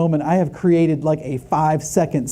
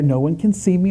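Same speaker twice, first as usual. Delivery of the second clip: ordinary talk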